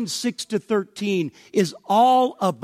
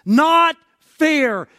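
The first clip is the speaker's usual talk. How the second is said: loud, high cry of protest; raised-voice accusation; indignant objection; 6 semitones higher, 7 dB louder, slower than usual. Acted. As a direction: object at full voice, stretching the key words. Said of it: "it rises up and says".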